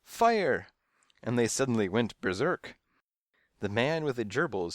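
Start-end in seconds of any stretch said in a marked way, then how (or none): 0.0-0.7 high, loud alarm cry; yell. A narrator's reading. Waiting room panics, yelling the alarm. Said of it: "they yelled".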